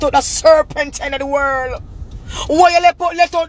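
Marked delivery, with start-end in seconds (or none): 2.5-3.5 higher, louder indignant shout; high indignant yell; high angry outburst. Real, not acted. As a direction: hurl the question at her. Ranting at rival.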